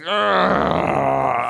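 long drawn-out "ugh" of disgust; exasperated groan. Real, not acted. Viewer sick of seeing stuff like this, long exasperated groan. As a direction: groan it out, fed up.

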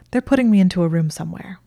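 ordinary delivery

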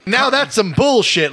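high, slow imitation of indignation; Samuel L. Jackson. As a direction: draw it out as the actor's signature protest. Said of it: "that's a Samuel L".

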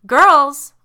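high, loud parental call. A narrator's reading. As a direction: call the girls from upstairs. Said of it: "a voice called".

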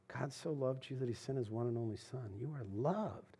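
soft, sincere confession of love; tender sincerity. Real, not acted. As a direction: say it quietly like you mean every word. Pastor softly tells congregation he loves them.

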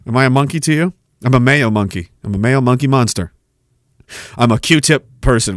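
normal talking voice

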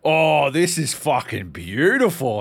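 loud mock reverence, drawn out; awestruck knife sharpener. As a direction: gush over the blade like a fan. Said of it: "This dude was like".

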